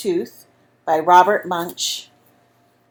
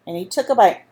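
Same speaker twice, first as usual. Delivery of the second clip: plain reading voice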